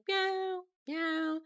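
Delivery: high meowing cat impression; imitating a cat. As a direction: meow up high like Sadie at the door. Voicing the cat's meow at door.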